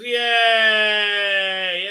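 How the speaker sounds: high, loud drawn-out yay; mock cheer. Magician after the vanishing trick, mock triumphant cheer. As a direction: stretch out a showman's mock cheer. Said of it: "he makes a portal and she vanishes and he goes".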